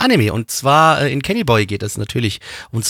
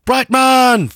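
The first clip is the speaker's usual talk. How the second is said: loud announcing call; 10 semitones higher, 4 dB louder than usual. Acted. Announcing the sketch.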